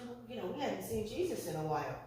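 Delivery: hushed, quick murmur to a companion; imitating puzzled parents under the breath; quiet wondering. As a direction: softly voice a dawning doubt. Parents begin noticing Jesus is missing.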